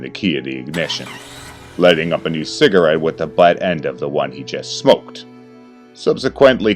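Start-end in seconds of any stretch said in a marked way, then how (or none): none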